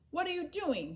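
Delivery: a hint of a stern policeman; policeman questioning. A narrator's reading. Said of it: "he asked".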